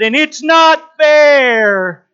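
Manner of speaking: put-on drawn-out, loud whining child; whiny kid complaint. Kid whining to dad in the parking lot, loud and drawn out. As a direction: whine it slowly like a sulking kid.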